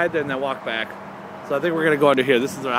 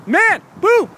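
shouted catchphrase; 7 dB louder than usual. Acted. Sign-off catchphrase.

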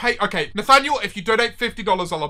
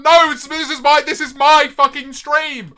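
loud, raised insistent voice; indignant outburst; 4 semitones higher, 5 dB louder than usual. Real, not acted. Streamer telling chat whose stream it is, indignant loud insistence. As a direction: snap back loudly, stretching the last words. Asserting control over his stream.

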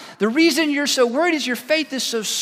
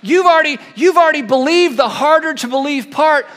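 loud, high exhortation; Jesus pressing the doubters to take the next step, loud; urging call; 5 semitones higher, 6 dB louder than usual. Acted. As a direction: push them hard, almost shouting. Jesus urging disciples, raised voice.

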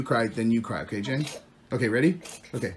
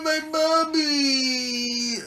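high, very slow mock wail; whiny playful cry-along; pretend crying; 7 semitones higher, much slower than usual. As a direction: fake bawling for mommy, drawn right out. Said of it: "we were all crying for mommy".

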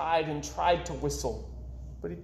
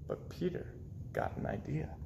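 ordinary delivery, nothing marked

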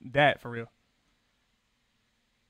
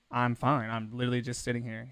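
low, softer retold protest; mock-calm denial; 6 semitones lower, 7 dB quieter than usual. Passenger told to calm down on plane, quietly protesting. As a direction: insist quietly that nothing is wrong.

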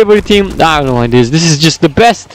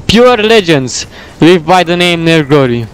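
ordinary talk

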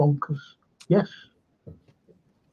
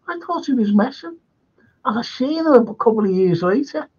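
ordinary talk, nothing marked

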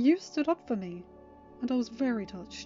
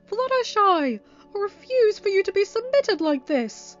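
loud, high, drawn-out outrage; theatrical furious protest, voice soaring; angry yell; 12 semitones higher, 13 dB louder, slower than usual. Acted. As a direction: yell your refusal in anger. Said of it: "he yelled, floating in anger".

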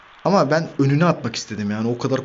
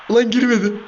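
higher, louder frustrated yell; frustrated outburst; 9 semitones higher, 5 dB louder than usual. Real, not acted. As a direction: groan it at the missed shot. Shot missed, frustration. (yüksek sesli hayal kırıklığı çığlığı)

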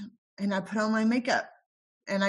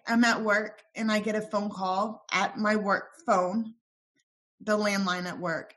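usual speaking voice

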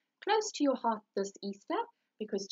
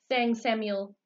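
plain reading voice

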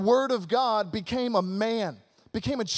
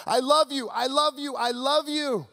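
louder, higher calling out; mild shout from heaven; 4 semitones higher, 3 dB louder than usual. Retold or imitated. Heavenly Father calling down to earth, a raised call. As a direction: call down from far above. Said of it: "shouting down to the earth".

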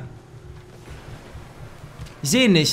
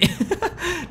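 pained laugh; 10 semitones higher, 9 dB quieter than usual. Real, not acted. Bit his own tongue.

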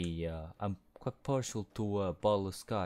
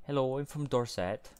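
higher-pitched, rough attempt at Dorset; Dorset farmer accent; 5 semitones higher, 4 dB louder than usual. Shown as a demonstration. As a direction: try a West Country Dorset farmer accent. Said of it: "Dorset-ish accent, like, former accent".

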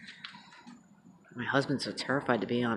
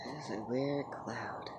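hushed whisper, slowly; quiet nighttime whisper; 9 dB quieter, slower than usual. Real, not acted. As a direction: whisper it softly.

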